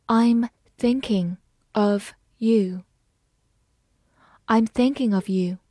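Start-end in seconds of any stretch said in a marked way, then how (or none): 0.1-2.8 slow, careful read; slow teacher demo. Shown as a demonstration. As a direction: read it slowly word by word. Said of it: "好，慢慢来读".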